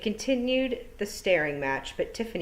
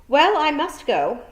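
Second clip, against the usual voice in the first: high, loud and slow announcement; a witch briskly ending the meeting, loud and high; brisk farewell; 8 semitones higher, 8 dB louder, slower than usual. A narrator's reading. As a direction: declare it grandly as she stands. Miss Tick abruptly rising to leave.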